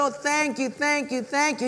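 high-pitched, gushing gratitude; effusive thanks. Acted. Mother who will not stop thanking the cobbler, effusive. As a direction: keep thanking, voice pitched right up.

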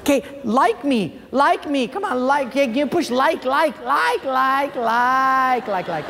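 high, needy repeated plea; mock begging for likes. Preacher imitating a Facebook giver asking for likes, mock begging. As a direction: play someone pestering everyone for approval.

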